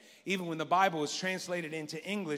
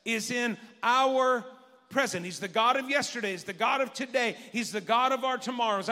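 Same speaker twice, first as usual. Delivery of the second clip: louder, higher preaching build; full-force preaching; 6 semitones higher, 4 dB louder than usual. Acted. Pastor exhorting the congregation, full-force preaching. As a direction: preach it with fire.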